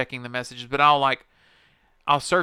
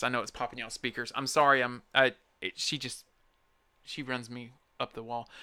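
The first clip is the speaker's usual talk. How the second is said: ordinary delivery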